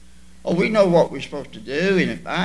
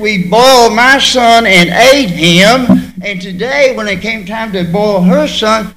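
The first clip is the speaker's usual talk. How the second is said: desperate complaint; 11 dB louder than usual. Retold or imitated. Mother's complaint in famine story.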